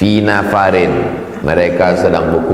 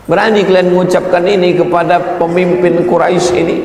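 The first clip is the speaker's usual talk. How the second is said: indignant rebuke; 10 semitones higher, faster than usual. Acted. Abu Bakar rebukes the Muslims.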